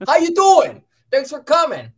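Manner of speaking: put-on loud, high Chicago accent; playful Chicago accent greeting. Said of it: "with a Chicago accent".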